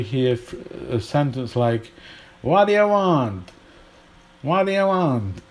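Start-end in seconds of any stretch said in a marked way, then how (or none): 2.4-5.4 high, run-together American "waddaya want"; elided American English. Shown as a demonstration. Teacher demonstrating American elision to learners, put-on American accent. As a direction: slur the words together in a mock American accent. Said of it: "There's more use of elision. So in American English".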